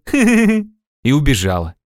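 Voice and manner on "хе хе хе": high, short acted giggle; laugh. A narrator's reading. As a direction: giggle at the nonsense. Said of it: "засмеялась Нюшка". (высокий смешок)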